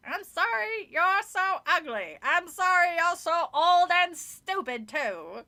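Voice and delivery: slow loud sneering puppet; raspy dummy insult. A narrator's reading. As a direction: drag out each insult.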